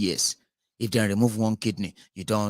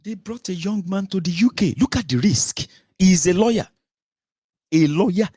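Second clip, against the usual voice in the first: excited outburst; 9 semitones higher, 6 dB louder than usual. Real, not acted. Outraged commentary on the boy.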